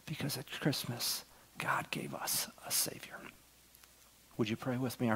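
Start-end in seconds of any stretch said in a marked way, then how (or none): 0.1-3.2 hushed and voiceless; whisper. Real, not acted. Pastor ending the sermon to the congregation, whispered. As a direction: let the last truth fall in a whisper. Preacher's closing point.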